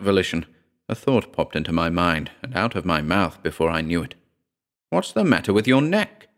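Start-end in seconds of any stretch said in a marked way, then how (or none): 4.9-6.3 sudden, higher-pitched query; abrupt, probing demand; abrupt question. A narrator's reading. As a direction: blurt out the question. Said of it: "I asked abruptly".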